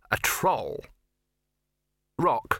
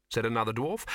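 plain reading voice

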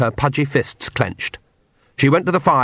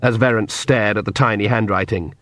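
usual speaking voice

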